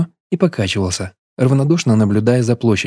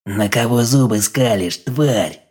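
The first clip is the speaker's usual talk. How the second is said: quiet hoarse voice; slower than usual. A narrator's reading. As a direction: rasp it quietly, like a smoker. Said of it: "тихим сиплым голосом спросил он".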